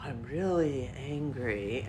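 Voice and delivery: slow, soft, gentle fake anger; slow, soft mockery of a calm man claiming he's angry; mock Mister Rogers anger. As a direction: pretend to be angry like a mild TV host. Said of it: "just like Mister Rogers".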